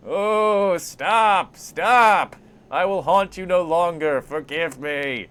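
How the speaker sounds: high, loud ghostly wail; begging for mercy. Acted. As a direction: cry out in hammed-up, drawn-out pleading. Beaten ghost pleads, thrown voice.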